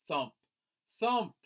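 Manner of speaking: plain reading voice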